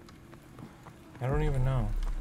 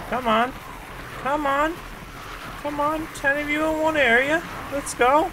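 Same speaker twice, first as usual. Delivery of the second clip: much higher, loud rallying calls; high-pitched urging; 4 dB louder than usual. Real, not acted. Player calling game zombies into one area, high urging. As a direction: egg them on in a high, loud voice.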